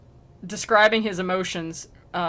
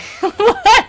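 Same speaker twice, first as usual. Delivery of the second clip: loud high laugh; 11 dB louder than usual. Real, not acted. Laughs at own joke.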